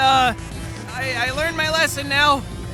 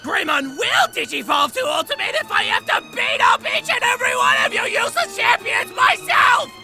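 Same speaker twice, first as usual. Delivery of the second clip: yelling in frustration; bossy angry yell; frustrated shouting; 8 semitones higher than usual. Acted. Kid screaming at his Digimon.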